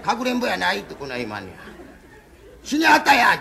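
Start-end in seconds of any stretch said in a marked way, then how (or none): 2.6-3.4 loud, far higher retold yell; retelling how his wife shouted the news at him; mock shout. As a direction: shout it back like it's obvious. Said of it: "ちゅうさかいね。".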